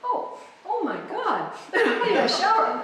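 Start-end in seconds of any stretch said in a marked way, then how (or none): 0.0-1.5 high, slow, laughing gasp of dismay; mock horror. Woman seeing herself after the deadline, mock-horrified and laughing. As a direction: act appalled at yourself. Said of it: "retold shock in the shower".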